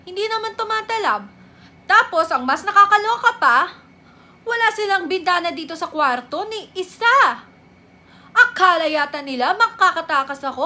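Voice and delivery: loud, high, rapid-fire complaint; exasperated rant. Acted. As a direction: rant indignantly about the hospital. Ranting complaint about hospital and doctors. (inis na inis na pagrereklamo)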